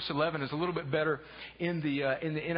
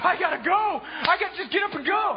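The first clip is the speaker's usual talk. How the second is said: loud, high rush to leave; urgent exclamation; 5 semitones higher, 4 dB louder than usual. Acted. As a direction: blurt it like you must go now. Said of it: "would just be like".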